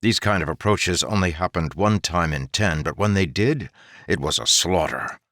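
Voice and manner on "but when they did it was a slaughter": plain reading voice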